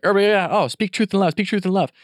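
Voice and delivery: high, breezy, dismissive parroting; mocking glib voice. Mocking people who say it but never do it, high and glib. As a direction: toss it off like an empty slogan. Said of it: "the whole idea ... is like".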